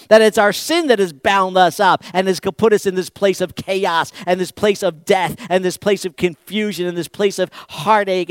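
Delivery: loud, high, impassioned preaching; forceful preaching. Real, not acted. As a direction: drive it home to the whole congregation.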